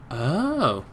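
usual speaking voice

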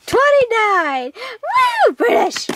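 high whooping celebration; excited cheer. Real, not acted. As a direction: cheer like you just won. Cheering at the right answer.

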